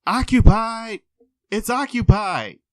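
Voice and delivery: high, loud yell from the stall; yelling occupied. Retold or imitated. Man in the restroom yelling at the door, loud. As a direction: shout it through the door. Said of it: "a guy in there who goes".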